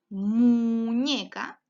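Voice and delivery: slow, drawn-out sounding out; slow syllable by syllable. Shown as a demonstration. Teacher stretching the word for a young learner, slowly. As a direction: lay the syllables out one by one. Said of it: "¿Cuál es su último sol".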